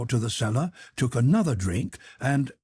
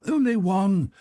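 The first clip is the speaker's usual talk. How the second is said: higher, louder call after the guest; crying out; 10 semitones higher, 4 dB louder than usual. Acted. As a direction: shout after the fleeing guest. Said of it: "crying out".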